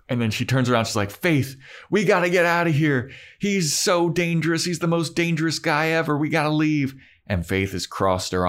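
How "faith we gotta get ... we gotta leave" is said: high, urgent put-on warning; hammed-up panicky plea to flee, pitched up; mock alarm. Buffy urgently warns Faith.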